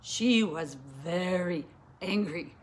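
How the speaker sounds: low, slow, ominous narration; angry emphasis. A narrator's reading. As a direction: drag out how furious she was. Narrator acts out the anger.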